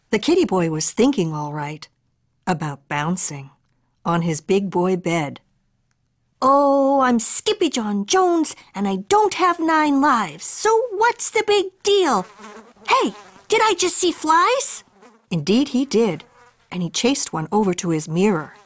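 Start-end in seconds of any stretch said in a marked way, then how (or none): 6.4-14.8 put-on higher boyish Skippy; bouncy kid on his bed; cat boy voice.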